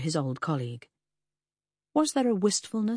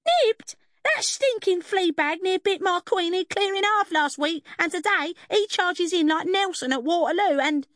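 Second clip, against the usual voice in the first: very high, loud screeching rant; outraged cockney fishwife in full flow; howling fishwife tirade; 14 semitones higher, 5 dB louder than usual. A narrator's reading. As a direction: screech on until cut off. Howling fishwife getting into her stride.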